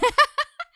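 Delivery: laugh. Real, not acted. Nervous laugh about disappointing Faith.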